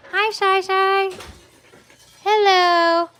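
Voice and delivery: high, loud happy greeting for the dog; excited dog greeting. Real, not acted. Breeder greeting the mother dog Shiloh, high and loud. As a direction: call out brightly to a dog you adore.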